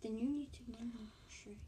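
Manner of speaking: soft teasing whisper. Real, not acted. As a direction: whisper the comeback. Teasing aside to sister.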